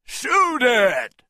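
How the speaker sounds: high, loud command; shouted order. A narrator's reading. The men urged to fire, a loud high shout. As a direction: yell the order fast. Men attacking escaping Kong.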